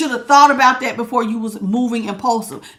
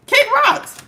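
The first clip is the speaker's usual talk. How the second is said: high mocking kick rocks; 13 semitones higher than usual. Real, not acted. Repeated in a high voice.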